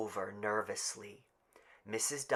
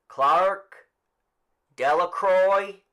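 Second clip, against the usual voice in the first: higher, louder slow roll call; raised slow roll call; calling out names; 9 semitones higher, 12 dB louder, much slower than usual. Acted. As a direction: read names out to the crowd.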